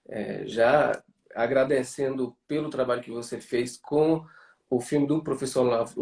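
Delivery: normal talking voice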